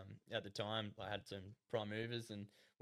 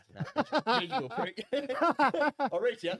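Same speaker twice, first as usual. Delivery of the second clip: laughing through mock outrage; chuckling as he grumbles, louder and higher; 13 semitones higher, 10 dB louder, much slower than usual. Real, not acted. Laughing while telling a friend about the unpaid teeth.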